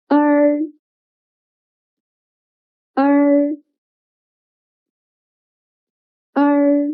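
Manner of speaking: er as one sound, tongue tip curled back. Shown as a demonstration. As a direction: hold each er evenly with a retroflex r. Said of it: "roll up the tip of your tongue backward".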